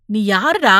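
stern question with rising stress; scolding demand. A narrator's reading. Man stepping back from the youth, scolding. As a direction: demand who he is. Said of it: "விலகிக் கொண்டே அதட்டினார்".

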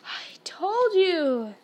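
high, drawn-out wail falling at the end; scolding. A narrator's reading. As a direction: stretch it out slowly in exasperated reproach. Winded Hermione repeats her scolding.

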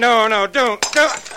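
high, loud panicked scream of no; terrified scream. Acted. Bartley screaming at Joyce as she strikes him, terrified. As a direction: scream as the ashtray comes down.

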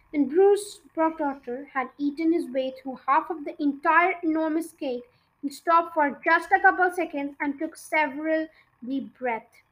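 ordinary delivery, nothing marked

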